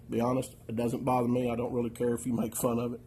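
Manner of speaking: normal talking voice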